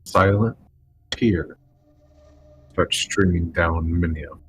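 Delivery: slow, hushed storyteller voice; scene setting. Acted. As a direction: describe it slowly with awe. Game master describes crowd.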